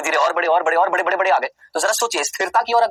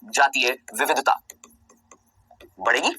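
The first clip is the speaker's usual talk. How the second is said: slow dictation; 7 semitones lower than usual. Shown as a demonstration. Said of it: "तो क्या मैं ये नहीं बोल सकता".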